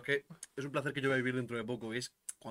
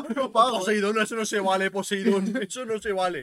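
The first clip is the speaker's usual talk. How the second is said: mock protest; 7 semitones higher, 11 dB louder, slower than usual. Protesting to the sea god.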